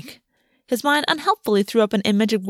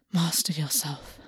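slow, soft breathy self-command; whisper; 4 semitones lower, 9 dB quieter, slower than usual. Acted. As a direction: whisper it to yourself, slowly. August steadying himself, self-command.

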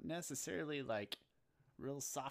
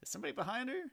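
confused surprise; 12 semitones higher, 5 dB louder than usual. Retold or imitated. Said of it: "I was like".

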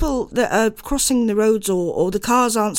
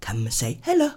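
low, lilting hello with a rising end; sing-song, hammed-up greeting; playful drawn-out hello; 7 semitones lower, 3 dB quieter than usual. Shown as a demonstration. Co-host inviting listeners to the county show, playful drawn-out hello. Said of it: "say it like that".

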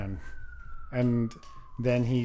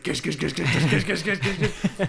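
repeated mouth-made gish gunshot sounds, a touch laughing; gunshot noises; 4 semitones higher than usual. Retold or imitated. Describing the shooting montage, mock gunfire noises. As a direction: rattle off fake gunfire with your mouth.

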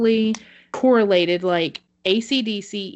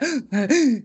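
theatrical preacher gasp; dramatic gasp; 4 semitones higher, 4 dB louder than usual. Retold or imitated. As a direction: gasp loudly at the end like the preacher. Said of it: "everything was like a".

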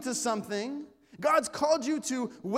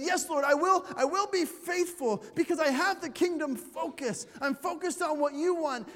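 eager vow; 6 semitones higher, 3 dB louder than usual. Retold or imitated. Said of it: "and you said".

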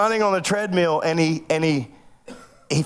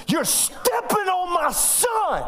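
slow, high, forceful warning; stern dad warning; 13 semitones higher, much slower than usual. Retold or imitated. Father warns bodyguard off his son, slow forceful warning. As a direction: lay down the law, word by word.

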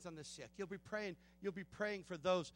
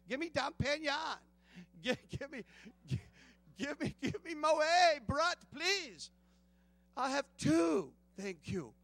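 louder, slow, hammed-up demand; mock champagne order; 4 semitones higher, 8 dB louder, slower than usual. Preacher mimicking his old self ordering champagne, hammed-up. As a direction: play the eager drinker calling for bottles.

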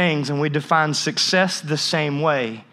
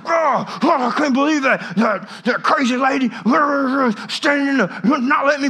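mock furious rant, much higher and shouted; 8 semitones higher than usual. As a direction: ham up an angry driver ranting at a lady. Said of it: "he becomes furious".

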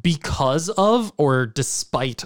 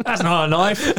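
higher, put-on Australian movie quote; Crocodile Dundee; 5 semitones higher than usual. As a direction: quote it in a mock Australian accent. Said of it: "I know that's Crocodile Dundee".

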